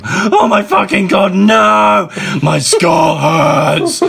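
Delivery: high, loud pained scream. A narrator's reading. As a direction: shriek in agony, dragging out the last words. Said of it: "he started to scream".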